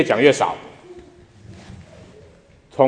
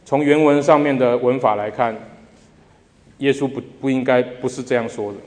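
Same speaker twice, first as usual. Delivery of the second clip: ordinary talk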